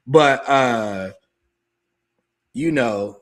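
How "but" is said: loud evil villain cackle; villain laugh. Shown as a demonstration. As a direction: throw your head back and cackle like a villain. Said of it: "I'm gonna do my villain laugh".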